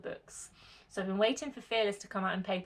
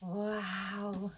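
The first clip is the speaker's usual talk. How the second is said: soft gasp of awe; 6 dB quieter than usual. Real, not acted. First look at pretty book.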